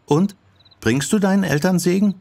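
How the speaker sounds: put-on high girlish questioning; inquisitive girl. Yoko quizzes her friend about the name, inquisitive. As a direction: press the question in a high young voice.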